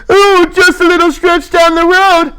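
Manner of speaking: very high, sing-song mock driver voice; sneering impression of the bus driver. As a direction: ham up the driver's breezy excuse. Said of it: "He kicks us out and says".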